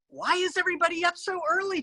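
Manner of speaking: high, soft and quick little mouse; small mouse voice. Acted. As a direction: wonder aloud like a sleepy tiny mouse.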